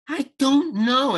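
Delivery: drawn-out, reluctant "I don't know", pitched high; sulky brush-off, dragging it out; sullen attitude. Retold or imitated. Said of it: "I'm just full out with my attitude".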